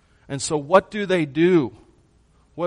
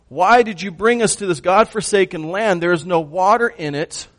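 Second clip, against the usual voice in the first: loud, raised-pitch imitation of a grumbling crowd; complaining again; 4 semitones higher, 7 dB louder than usual. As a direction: protest loudly, playing the aggrieved people. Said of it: "The people are complaining again".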